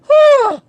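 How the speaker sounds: high-pitched whew; exasperated whew. Real, not acted. Woman fed up with mover's mess, exasperated whew.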